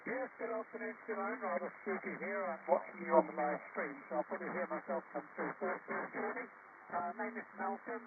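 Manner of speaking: faint, distant voice over a radio link; like a caller on a ham radio; radio transmission. Real, not acted.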